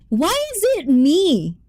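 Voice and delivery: high-pitched, playful protest; mock protest. Real, not acted. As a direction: protest as if unfairly singled out. Protests chat's teasing about fujoshi.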